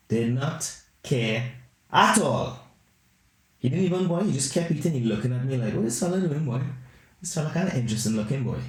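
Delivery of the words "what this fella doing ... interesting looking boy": lightly voiced raccoon in a Caribbean-style accent, higher; a hint of a Caribbean accent for the raccoon. Raccoon sizing up the man, Caribbean accent, higher. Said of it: "His accent doesn't match his body".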